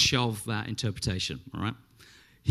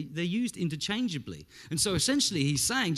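usual speaking voice

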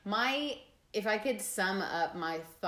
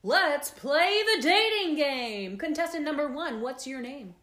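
flirty teasing dating host; 7 semitones higher, 7 dB louder than usual. Acted. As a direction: work the room like a dating host. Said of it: "Hence the cheesy opening".

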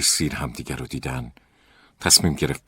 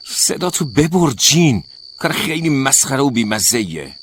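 much higher, louder angry yell; angry shout; 10 semitones higher, 9 dB louder than usual. A narrator's reading. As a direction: yell at the roommate to shut up. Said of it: "داد زد و گفت". (فریاد عصبانی و بلند)

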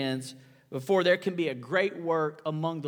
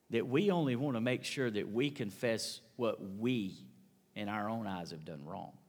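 ordinary talk

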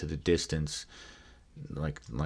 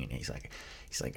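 ordinary talk

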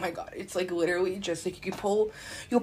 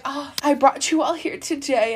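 higher, loud, slow announcement with a laugh; self-satisfied gathering host; 7 semitones higher, 6 dB louder than usual. Retold or imitated. Disorder personified addressing her gathered griefs, grandly amused. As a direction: announce it grandly to the assembled crowd. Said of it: "She's just like".

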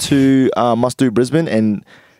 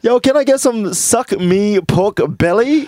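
a slow, higher, put-on order at the counter; mock customer order; 7 semitones higher, slower than usual. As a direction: play a customer ordering with a straight face. Acting out ordering the dish.

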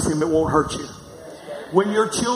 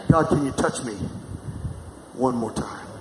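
tiefer, leiser, flehender Gebetston; pleading prayer; 5 semitones lower, 5 dB quieter than usual. Pretend. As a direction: bitte Gott leise und sehnsüchtig. Preacher voicing a longing prayer.

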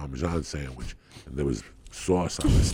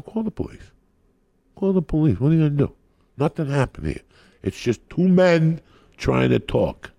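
defiant shouting; 10 semitones higher, 7 dB louder than usual. Retold or imitated. Yelling at AD threatening police.

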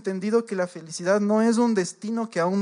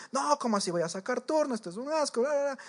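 higher-pitched mock outrage, a bit softer; mock indignant lady; 7 semitones higher, 5 dB quieter than usual. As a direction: huff like an offended customer in a queue. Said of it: "Llega pero bravísima". (señora indignada, más aguda, imitada)

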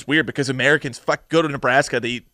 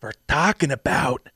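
Yanni Gogolak impression; 2 semitones lower than usual. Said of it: "my impression of this character".

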